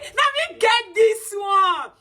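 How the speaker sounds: slow, high, laughing mock quote. Vlogger mocking critics of the team with a high, slow, laughing mock voice. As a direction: drag out let me get this one high and slow, laughing. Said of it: "Like, let me get this one".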